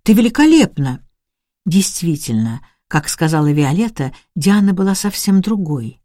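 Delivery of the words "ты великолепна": higher-pitched, awed exclamation; exclaiming in admiration. A narrator's reading. Richard exclaims to the transformed Diana, admiring cry. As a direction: call out your admiration, pitch lifted. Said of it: "закричал он".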